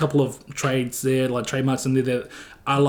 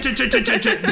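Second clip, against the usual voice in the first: high, repeated "chit" noises; imitated mic chatter; 9 semitones higher than usual. Correcting the interviewer's imitation of the streamer, high chattering "chit" noises. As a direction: mimic rapid chatter in a raised pitch. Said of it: "It's chit".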